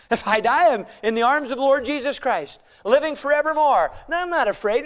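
forceful preaching, key words punched; emphatic preaching. Real, not acted. Preacher urging believers to be bold in Christ, emphatic preaching. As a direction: preach it boldly with big rises and falls.

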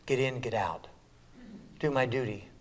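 ordinary talk

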